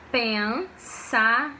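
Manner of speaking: "Pensamento" slowly, syllable by syllable; split into syllables. Shown as a demonstration. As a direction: break "Pensamento" into pen, sa, men, to. Syllable-by-syllable demo of the word.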